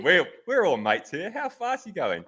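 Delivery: high, wheedling retold plea; coaxing. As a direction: coax it out of a mate, voice climbing. Retold coaxing of a tight-lipped rider.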